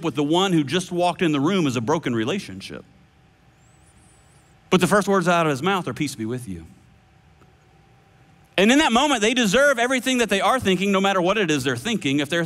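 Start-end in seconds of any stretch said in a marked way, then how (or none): 5.9-6.6 hushed and soft, a calm peace over frightened men; gentle whispered blessing. Acted. Jesus greeting his fearful disciples, hushed and tender. Jesus' first words, quoted again.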